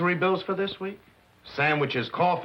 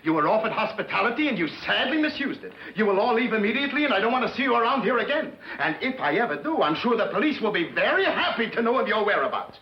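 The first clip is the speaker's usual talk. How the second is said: furious eviction; 4 semitones higher than usual. Acted. Husband ordering the gamblers out, shouting angrily. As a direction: throw them out of the house, yelling.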